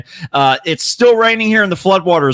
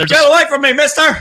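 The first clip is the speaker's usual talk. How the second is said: mock panic; 8 semitones higher, faster than usual. Joining the mock spider panic.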